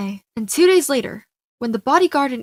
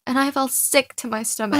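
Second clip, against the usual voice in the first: ordinary delivery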